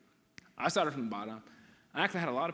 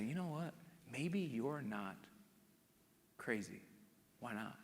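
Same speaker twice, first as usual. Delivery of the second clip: gentle softly spoken encouragement; 11 dB quieter, slower than usual. Acted. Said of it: "gentle encouragement".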